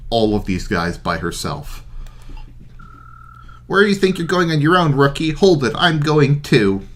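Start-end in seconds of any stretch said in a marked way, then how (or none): 3.7-6.8 put-on high, eager young knight voice; cocky young knight calling out; brash young knight. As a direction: call after the rookie, cocky and keen. Voicing a character in game.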